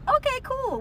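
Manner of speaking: squeaky high "Okay, cool"; high-pitched "okay". Retold or imitated. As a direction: jump way up on okay. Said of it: "And I was like".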